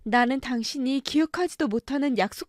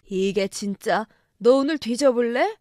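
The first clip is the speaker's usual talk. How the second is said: louder menacing threat; father threatening his son at the table, louder; angry threat; 4 dB louder than usual. Acted. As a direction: threaten him, voice raised.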